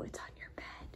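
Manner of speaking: hushed, secret hint; whispering. Acted. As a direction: whisper the clue to the child.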